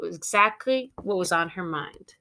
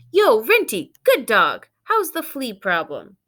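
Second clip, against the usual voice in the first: loud cheeky greeting; 3 semitones higher, 6 dB louder than usual. Acted. Martha on a walk, greeting another dog with a loud jeer. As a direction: call out to a passing dog cockily.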